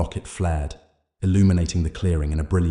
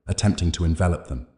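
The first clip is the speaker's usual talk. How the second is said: ordinary delivery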